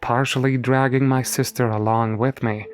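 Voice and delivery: ordinary delivery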